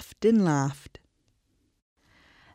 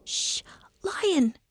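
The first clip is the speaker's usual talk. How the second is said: whispered shh then high squeak; hushing mouse; 12 semitones higher, 3 dB quieter than usual. Acted. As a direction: hush the lion like a tiny mouse. Mouse hushing trapped lion.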